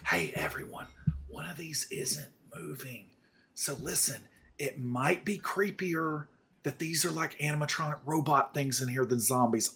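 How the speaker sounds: hushed whisper to the group; very soft, secretive warning. Acted. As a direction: whisper it so only your companions hear. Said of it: "I don't know why I'm whispering".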